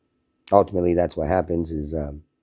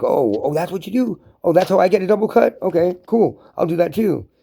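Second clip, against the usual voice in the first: much higher, put-on voice of a co-worker eagerly playing along; mocking a naive co-worker; 11 semitones higher, 4 dB louder than usual. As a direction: play the eager, clueless yes-man. Mocking a co-worker who plays along.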